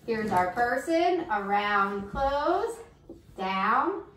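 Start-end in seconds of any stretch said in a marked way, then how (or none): 1.3-4.0 much softer, step-by-step directions; soft drawing steps. Acted. Teacher guides kids drawing a person, softly. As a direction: murmur the strokes as you draw.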